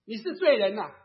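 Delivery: loud, pointed accusation; imitated loud reproach; accusing. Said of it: "人家会指责我".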